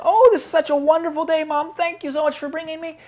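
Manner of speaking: put-on high, quick child voice; grateful little boy. Little boy thanks his mom at the beach, grateful. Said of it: "being so grateful".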